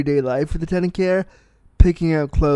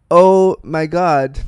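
slow, louder drawn-out "Oh"; stunned disbelief; 6 dB louder, much slower than usual. Retold or imitated. Retelling a film character's reaction to his cat, stunned. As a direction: stretch it out in mock dismay.

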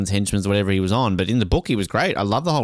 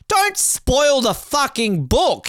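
high, slow, drawn-out protest; mock outrage at a spoiler; exasperated outburst; 13 semitones higher, much slower than usual. Podcaster venting at the intro writer, exasperated and high.

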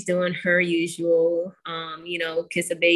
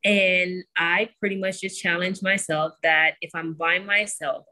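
ordinary talk, nothing marked

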